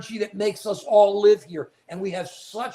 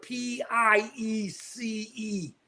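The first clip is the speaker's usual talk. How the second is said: slow, pointed letter by letter; deliberate, drawn-out spelling; 4 semitones higher, 5 dB quieter, much slower than usual. Real, not acted. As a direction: spell each letter out slowly and higher.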